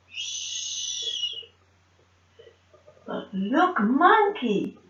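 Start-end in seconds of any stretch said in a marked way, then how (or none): 0.1-1.4 soft drawn-out shush; long "shh". Shown as a demonstration. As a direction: hush them gently. Hushing to show quiet.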